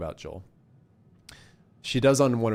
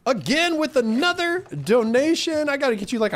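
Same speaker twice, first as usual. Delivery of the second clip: excited shoutout; 6 dB louder than usual. Real, not acted. Thanking donor excitedly on stream.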